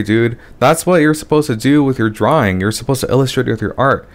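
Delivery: ordinary talk, nothing marked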